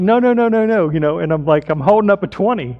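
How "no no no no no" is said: much higher and louder, urgent repeated no; hammed-up panicked pleading; frantic pleading. Pleading with fleeing woman in story.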